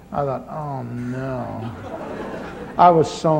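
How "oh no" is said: embarrassed groan. Retold or imitated. Mother and daughter crying forward.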